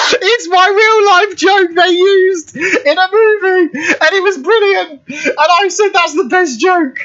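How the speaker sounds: squealing high through real laughter; helpless laughter. Real, not acted. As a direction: voice shoots up, nearly crying with laughter. Helpless laughter at own joke.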